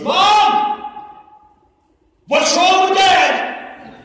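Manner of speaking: high, loud, drawn-out yell to mom; alarmed child's shout. Retold or imitated. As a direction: imitate a boy hollering for his mother. Son calls out in alarm.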